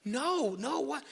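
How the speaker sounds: high-pitched, innocent disbelief; baffled younger brother. Acted. David answering his mad older brother, baffled and high-pitched. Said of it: "And David's like".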